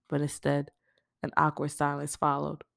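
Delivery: plain reading voice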